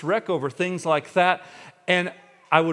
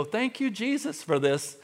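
mock thanks; 6 semitones higher than usual. Shown as a demonstration. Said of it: "I don't raise my hand and go".

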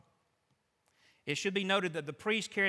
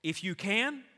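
incredulous echo; 4 semitones higher, 5 dB louder than usual. Acted. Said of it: "Look what Jesus said".

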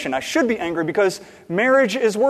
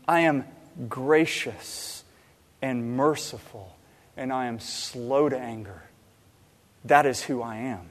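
soft, low, with pauses between phrases; tender, solemn self-revelation; gentle divine reassurance; 5 semitones lower, 6 dB quieter than usual. Retold or imitated. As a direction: lower your voice and let each attribute settle. Voicing God tenderly answering Moses.

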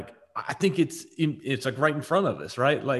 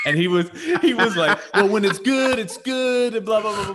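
laughing; 9 semitones higher, 6 dB louder than usual. Retold or imitated. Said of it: "retelling Gerald's excuse, laughing".

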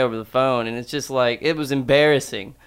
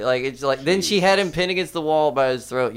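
normal talking voice

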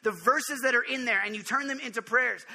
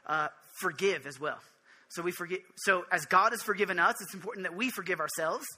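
ordinary talk

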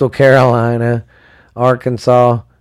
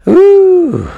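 high, loud ooh of excitement; excited ooh; 7 dB louder than usual. Real, not acted. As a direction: let out a big hyped ooh. Hyped for rivalry weekend.

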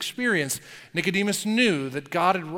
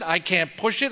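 ordinary talk

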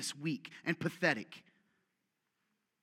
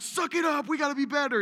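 high, loud coach barking at the guys; coach yell; 9 semitones higher, 9 dB louder than usual. Retold or imitated. Preacher imitating himself coaching men, loud and high. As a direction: push the men hard. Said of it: "trying not to be the coach".

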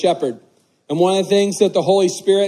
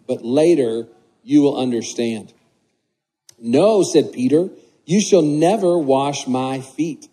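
scripture reading; 3 semitones lower than usual. Acted. Reads the scripture passage aloud.

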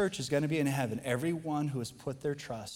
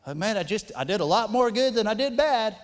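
higher, louder mock self-justification; good old boy excuse; 6 semitones higher, 7 dB louder than usual. Good old boy arguing his way into heaven, mock self-justifying. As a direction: plead your own case as a good old boy.

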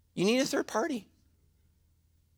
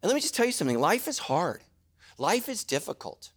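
ordinary talk, nothing marked

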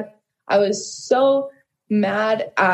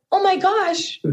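high, stunned exclamation; amazed gasp; 9 semitones higher, slower than usual. Real, not acted. At the piano, stunned by hearing God sing back. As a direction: blurt it out in awe.

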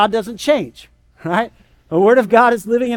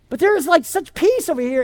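higher pleading tone; pleading believer; 8 semitones higher than usual. Retold or imitated. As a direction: coax them toward the peace. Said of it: "and you're going".

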